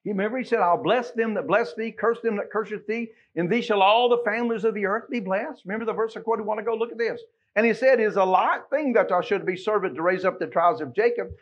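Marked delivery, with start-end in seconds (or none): none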